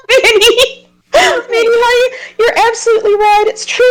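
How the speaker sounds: high, hammed-up crying voice; fake-sob it over the top; mock wail. Player mock-crying at Minnie's harsh verdict.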